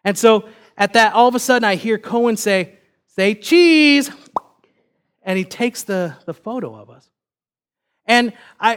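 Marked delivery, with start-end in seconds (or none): 3.1-4.1 put-on high, loud drawn-out kid's "say cheese"; toddler calling out. Three-year-old son to family with the camera, excited call-out. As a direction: imitate a small kid announcing a photo, louder and higher.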